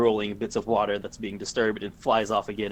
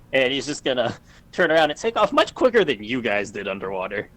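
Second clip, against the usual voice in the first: ordinary talk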